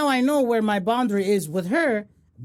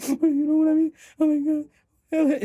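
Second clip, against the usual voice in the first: higher, whiny mock dismay; mock sad face; 6 semitones higher than usual. Friend retelling the kid's TikTok, mock sad whine. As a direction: play the kid's hurt face for laughs. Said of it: "he had the sad face".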